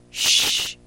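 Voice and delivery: a sharp breathy shush; hushing murmur. Acted. Pook hushing the sobbing Karina, a sharp shush. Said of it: "Pook murmurs".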